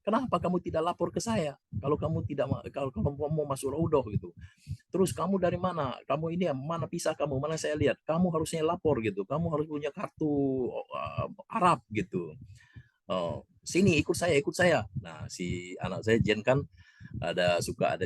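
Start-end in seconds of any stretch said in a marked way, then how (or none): 0.0-4.0 higher, softer reproachful question. Retold or imitated. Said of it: "Kata orang itu". 4.9-14.9 higher, pressing questions. Retold or imitated.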